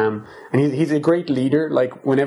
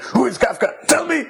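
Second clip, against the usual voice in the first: much higher, laughing, hammed-up police chief demanding an answer; mock demand; 14 semitones higher than usual. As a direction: squeal it out in mock outrage through laughter.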